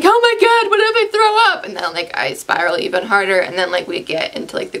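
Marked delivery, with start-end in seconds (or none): 0.0-1.6 high, frantic panicked cry; mock panic, voice jumping up high; a touch of hammed-up fear; panicky. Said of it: "I'm like".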